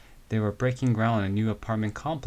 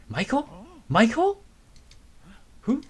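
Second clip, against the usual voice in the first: much higher, louder shocked call of the name; surprised calling; 14 semitones higher, 5 dB louder than usual. Acted. Recognizing the voice, calling out.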